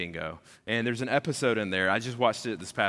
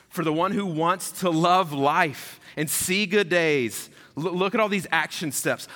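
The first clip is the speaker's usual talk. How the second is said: preaching scripture; 5 semitones higher, 4 dB louder than usual. Acted. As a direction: proclaim the verses with building fervor. Reads scripture with rising force.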